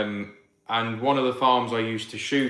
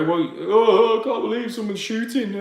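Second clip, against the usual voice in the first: mock complaining townie, pitched up; whiny townie; 9 semitones higher, 4 dB louder than usual. Mocking townies who complain about shooting, whiny put-on voice. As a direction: lampoon a prissy newcomer's whine. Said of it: "They complain like mad and go".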